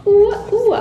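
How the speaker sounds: ordinary talk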